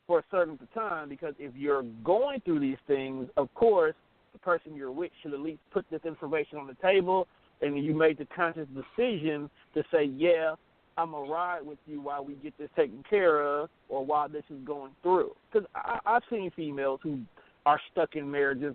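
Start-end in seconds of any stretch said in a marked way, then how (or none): none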